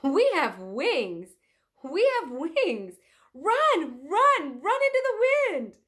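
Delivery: loud, high, slow joyful shouting rising to a squeal; laughing with joy. Acted. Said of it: "he burst out laughing".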